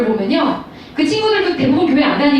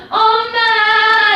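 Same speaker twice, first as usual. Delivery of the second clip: put-on high, long-drawn child's call; child calling from afar; 6 semitones higher, much slower than usual. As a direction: sing out for mum as an eager little child. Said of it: "저기 멀리서부터".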